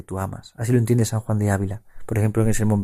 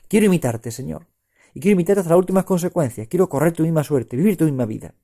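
raised, higher prayerful plea to Christ; fervent prayer; 5 semitones higher than usual. Acted. Preacher voicing the oblation to the Lord, fervent prayer. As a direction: pray it out, ardent and lifted.

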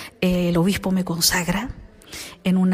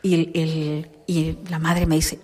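ordinary talk, nothing marked

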